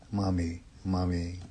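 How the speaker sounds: low, soft sleepy murmur. A narrator's reading. Child falling asleep after mother's comfort, softly murmuring. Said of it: "softly murmuring".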